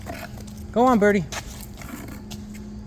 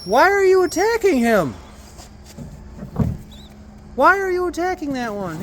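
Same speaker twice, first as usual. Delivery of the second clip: alarmed; 10 semitones higher than usual. Real, not acted. Birds fighting.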